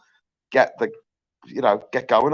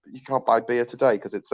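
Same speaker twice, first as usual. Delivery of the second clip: usual speaking voice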